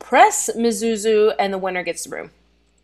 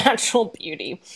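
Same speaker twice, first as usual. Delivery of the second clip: ordinary delivery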